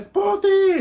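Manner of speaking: high, loud call across the block. Retold or imitated. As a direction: yell the nickname from far away. Best friend calling her name.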